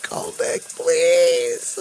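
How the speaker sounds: high wailing please; mock sobbing plea. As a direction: imitate a sobbing girl begging. Imitating sobbing girlfriend.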